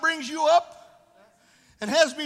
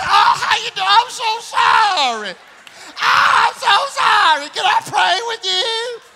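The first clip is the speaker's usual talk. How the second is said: hammed-up pity, high and loud, slowed; mock sympathetic churchgoer; 8 semitones higher, 9 dB louder, slower than usual. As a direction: overdo the fake sympathy, half crying. Mocks oversympathetic church members.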